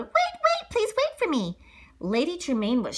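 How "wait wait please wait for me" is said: put-on high girl voice calling after them. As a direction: call out to make them wait.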